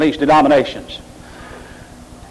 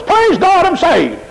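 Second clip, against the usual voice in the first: mock excited praise; 13 semitones higher, slower than usual. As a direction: parody a worshipper's sudden thrill. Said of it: "gets excited at the moment".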